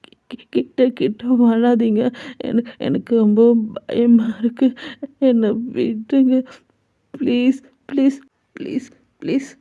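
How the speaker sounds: put-on high, trembling child voice stammering then whispering please; scared stammer. A narrator's reading. Jungkook begging the approaching mother, frightened and stammering. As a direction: shrink back and beg in fright. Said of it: "ரொம்ப பயந்து போய்".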